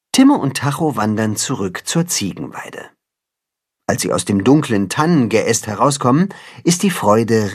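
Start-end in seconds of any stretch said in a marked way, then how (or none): none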